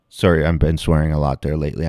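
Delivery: normal talking voice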